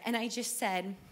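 usual speaking voice

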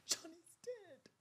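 high, slow, broken; stricken. Retold or imitated. Telling the friend her son died, high and slow.

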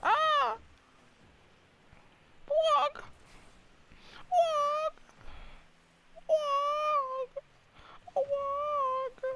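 very high, squeaky silly yelp; squealed mock cry. Gamer teasing teammates mid-match, squealed mock cry. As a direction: put on a tiny falsetto squeal.

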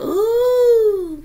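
startled high ooh cry. Real, not acted. Sudden reaction mid-warning.

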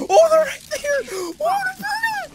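sudden high, loud panicked cry; frightened high shout at the monsters; panicked high yell. Real, not acted. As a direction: yelp it in real fright as they appear.

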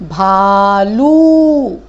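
भालू slow and loud, ऊ held long; correct long ऊ. Shown as a demonstration. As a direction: model the right भालू. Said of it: "teacher models word for class".

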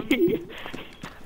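high, soft giggle; quiet burst of giggling; laugh. Real, not acted. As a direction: break into a light high laugh. Laughs after mock threat.

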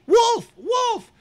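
put-on high, loud, shrill-pitched boy's call; boy crying wolf. Narrator voicing the boy crying wolf, high calling out. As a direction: call out like a bored shepherd boy faking alarm.

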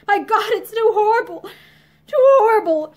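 loud, high cry of horror; agitated. A narrator's reading. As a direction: break out in anguished agitation. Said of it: "he said in agitation".

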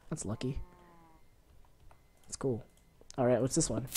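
ordinary delivery

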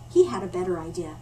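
plain reading voice

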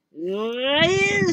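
high, loud troll shriek. A narrator's reading. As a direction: scream the word in outrage. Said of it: "skreg trolden".

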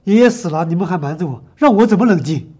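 loud, hurt and furious; angry shout. Acted. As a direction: shout it back at him. Angry at father for hiding death.

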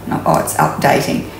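usual speaking voice

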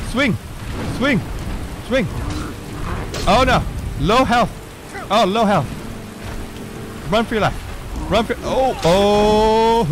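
flee for your life; panicked. Real, not acted. Near death, fleeing giants.

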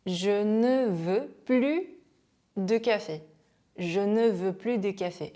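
slow teacher's French, "plus" said as "plu"; silent S model. Shown as a demonstration. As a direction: sound out the sentence slowly, no S in "plus". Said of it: "이렇게 발음해주면 되겠죠".

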